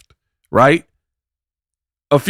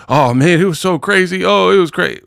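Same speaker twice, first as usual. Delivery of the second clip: higher, hammed-up gushing "so crazy"; lightly voiced mock gushing; mocking imitation; 7 semitones higher than usual. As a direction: parody her overexcited embellishing. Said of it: "And you saying it was so crazy, embellishing like a mug".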